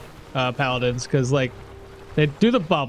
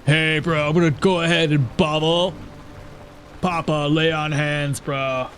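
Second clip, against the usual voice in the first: surfer bro voice; 3 semitones lower than usual. Retold or imitated. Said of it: "talks like a surfer".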